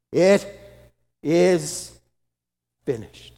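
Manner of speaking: loud cry fading at the end; shout. Acted. Jesus crying out his final words, loud then fading. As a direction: shout it out, then let it drop away. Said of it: "shouted those three words".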